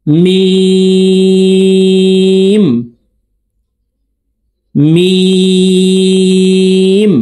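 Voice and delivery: লাম মিম নুন each chanted very slowly and high; three-alif stretch. Shown as a demonstration. Teacher drills viewers, chanting stretched letters. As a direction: draw each letter out long. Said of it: "এই হরফগুলিকে তিন আলিফ টেনে উচ্চারণ করব".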